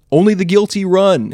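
loud, high shout. A narrator's reading. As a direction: shout it down from the sky. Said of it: "he shouted from the sky".

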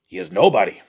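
loud, angry insistence; seething. A narrator's reading. Old man dismisses the captive's worth, seething. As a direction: insist forcefully, still seething. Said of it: "seethed Yotsu".

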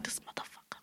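breathless whisper through a tear; whispered curse. Retold or imitated. Telling friends about crying in the car, whispered curse. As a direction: hiss it under your breath. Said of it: "a tear fall, like".

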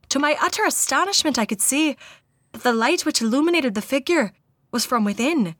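put-on careful Irish accent, reading a witness's account; resume the tale with an Irish lilt; amateur Irish accent, deliberate storytelling; attempted Irish accent. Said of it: "accents and dialects class that I took".

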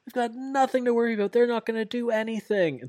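high, fast cocky taunt; hammed-up wrestler bravado; mock taunting. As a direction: gloat at cowards. Moxley mocking rivals.

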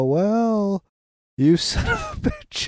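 hammed-up high wailing curse; mock cry. Cast member betrayed by Mark, hammed-up wailing curse. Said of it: "He's like".

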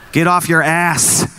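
ordinary talk

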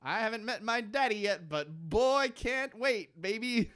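put-on a baby who can't wait to meet dad; mock fetus. S0 voicing the fetus.

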